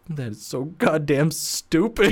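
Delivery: slow, drawn-out grudging delivery; imitating Joel. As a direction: stretch it out slowly in mock disbelief. Imitating Joel's deadpan reaction to pun.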